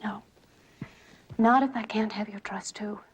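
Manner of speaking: whispering. Acted. Wife pleading softly.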